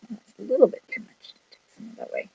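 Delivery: under-the-breath whisper. Real, not acted. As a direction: whisper to yourself while working. Concentrating on fine work.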